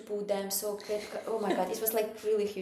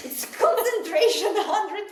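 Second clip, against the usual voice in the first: laughing; 12 semitones higher, 9 dB louder, slower than usual. Real, not acted. Laughing about cleaning joy.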